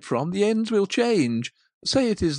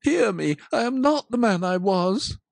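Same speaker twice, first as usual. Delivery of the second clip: louder, higher desperate plea; loud pleading; 5 semitones higher, 7 dB louder than usual. Acted. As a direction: Scrooge begging the spirit for hope. Scrooge begs the ghost.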